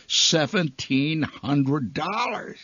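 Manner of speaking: each word stretched out slowly; awed jackpot call. Acted. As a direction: savour the huge sum, very slowly.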